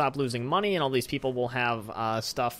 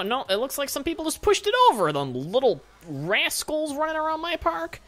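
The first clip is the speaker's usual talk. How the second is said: high-pitched, loud indignation; worked-up and scandalized, pitched way up; indignant outburst; 5 dB louder than usual. Real, not acted. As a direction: cry out in outrage at little troublemakers. Player finds bins pushed over.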